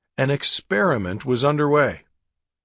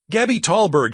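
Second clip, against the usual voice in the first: higher and louder shriek of her own name; 7 semitones higher, 4 dB louder than usual. Acted. Gabby shrieking her name to Drake, loud and high. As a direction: shriek it in a panic. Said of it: "she shrieked".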